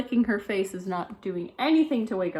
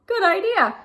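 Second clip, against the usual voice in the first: delighted parents; 12 semitones higher, 6 dB louder than usual. Acted. Parents agree.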